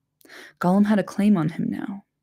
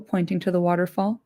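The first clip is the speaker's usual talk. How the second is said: ordinary delivery, nothing marked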